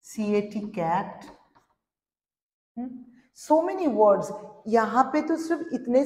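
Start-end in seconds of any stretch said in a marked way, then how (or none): 0.0-1.2 CAT spelt slowly, then cat; slow spelling. Shown as a demonstration. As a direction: spell each letter patiently for the children. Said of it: "ট্যাপও use করতে পারি আমরা". (ধীরে বানান করে বলা)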